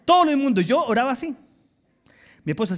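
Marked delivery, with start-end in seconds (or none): 0.0-0.6 loud, urgent plea to God; pleading prayer. Retold or imitated. Preacher voicing David's desperate cry, loud. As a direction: beg to be grabbed and pulled out. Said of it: "Él está diciendo".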